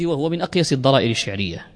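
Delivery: ordinary talk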